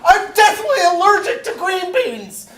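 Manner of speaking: high loud panicked shouting; panicked reaction. Acted. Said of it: "New reaction".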